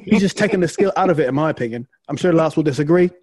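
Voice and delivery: put-on British accent; Matthew Fitzpatrick impression. Co-host imitates a golfer dismissing a rival's skill, British accent. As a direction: carry on quoting as the British golfer. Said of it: "was a great impersonation of that".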